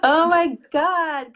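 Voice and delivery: slow, loud, high delighted gasp; excited gasp. Real, not acted. Thrilled reply when asked about their program. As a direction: gush with real delight.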